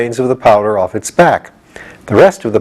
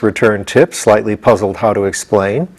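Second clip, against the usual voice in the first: ordinary delivery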